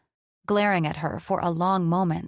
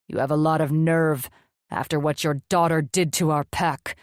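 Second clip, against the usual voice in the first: gruff demon lord voice; 2 semitones lower, 3 dB louder than usual. A narrator's reading. Dragomir's voice, rebuking djinn.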